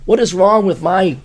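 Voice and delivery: normal talking voice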